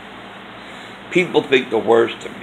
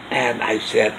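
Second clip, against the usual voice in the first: ordinary talk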